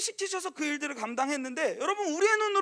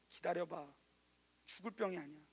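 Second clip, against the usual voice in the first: much softer, lower, tender; gentle reassurance; 10 semitones lower, 16 dB quieter than usual. Retold or imitated. Elijah reassures the widow, much softer. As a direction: soothe her quietly.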